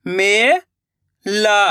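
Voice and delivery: Mela drawn out slowly, syllable by syllable; slow syllable split. Shown as a demonstration. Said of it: "मैं किस तरीके से".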